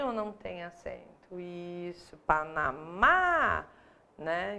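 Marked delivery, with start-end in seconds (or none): 2.2-3.7 "Panamá" slowly, swelling on a long final MÁ; tônica final destacada. Shown as a demonstration. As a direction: stretch and lift the last syllable of "Panamá". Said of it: "a sílaba tônica aí". ("Panamá" devagar, com MÁ forte e alongado)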